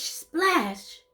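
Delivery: usual speaking voice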